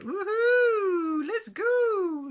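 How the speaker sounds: high, loud whoop of delight; gleeful gnome. Pretend. Gnome in the picture setting off, gleeful whoop. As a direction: whoop it like he's off on an adventure. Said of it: "He does look a bit as if he's a bit".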